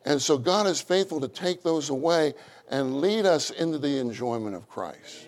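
normal talking voice

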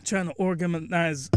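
ordinary talk

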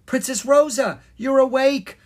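high, loud exclamation; astonished cry. A narrator's reading. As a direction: cry out in amazement. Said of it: "Miranda cried".

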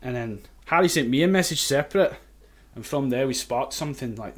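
put-on chatty anecdote in a convincing Scottish accent. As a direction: tell it casually as a Scot. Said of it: "I'm not even Scottish".

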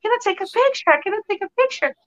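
high, chirpy British teen mimicry; imitated British accent. Actress imitating London teens' catchphrase, mock British accent. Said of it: "Com o sotaque deles".

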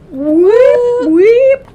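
loud high siren whoops; alarm whoop. Retold or imitated. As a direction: wail it like an alarm. Imitating the alarm going off.

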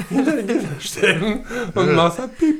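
can barely get the words out for laughing; laughing while talking. Real, not acted. Explains joke while laughing.

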